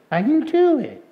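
high puppet voice, not yet convinced; reluctant piglet puppet. Acted. Puppet's second try for the puppeteer, high and reluctant. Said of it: "say it like you mean it".

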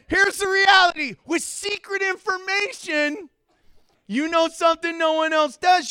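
high, loud preacher's proclamation; preaching at full force. Acted. Pastor proclaims the lesson to the congregation, full-force preaching. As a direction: drive the point home to the church.

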